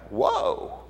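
high, soft stunned exclamation; astonished new parents. Acted. Joseph and Mary as shepherds come in, softly astonished. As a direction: breathe it out high, taken aback. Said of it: "Joseph and Mary were probably like".

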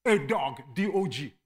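normal talking voice

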